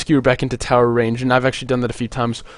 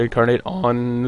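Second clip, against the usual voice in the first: voice crack; slower than usual. Real, not acted. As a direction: voice cracks mid-word. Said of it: "ooh, voice crack".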